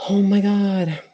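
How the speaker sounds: high, drawn-out exclamation; awed. Real, not acted. Telling how they once saw Jesus, awed. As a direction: let it burst out in astonishment.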